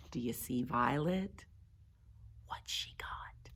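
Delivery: hushed, curious whisper; whispered question. Real, not acted. Reader asking children about Violet, whispered. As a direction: whisper to draw the listener in.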